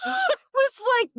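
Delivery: high hammed-up gasping cry; theatrical gasp before speaking; mock gasp. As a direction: gasp in exaggerated dismay. Gasps, high crying pitch.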